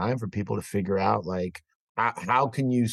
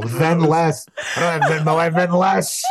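delighted; 6 semitones higher, 6 dB louder than usual. Real, not acted. Delighted riff on pun.

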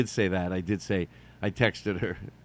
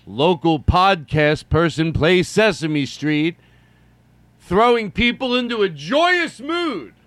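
slow, high announcer read of a headline; mock news headline; 5 semitones higher, 5 dB louder, slower than usual. Newsman announcing his own headline, slow and high. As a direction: read it like a breathless local news anchor. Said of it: "Have me on the news".

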